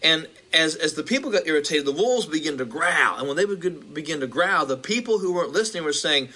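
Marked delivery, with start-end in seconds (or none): none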